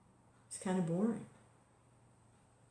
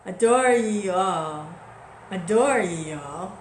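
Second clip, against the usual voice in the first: loud, slow, drawn-out declaiming; prayer recitation; 2 semitones higher, 10 dB louder, slower than usual. Acted. Teacher reciting his guru's prayer, loud slow declaiming. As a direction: stretch out "Adore ye all", stressing the DOOR.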